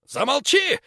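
very high, loud shriek. Acted. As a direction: shriek it to silence the boy. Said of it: "взвизгнул Светочей".

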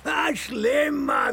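scornful laugh, throwing the word back; mock the word with a bitter laugh; grieving father laughing scornfully at the advice; bitter laughing echo.

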